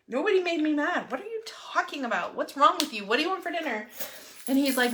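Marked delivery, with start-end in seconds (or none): 0.1-3.9 higher-pitched mock indignation; exasperated retort. Wife retells answering her worried husband, exasperated. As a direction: fire back baffled questions, pitched up.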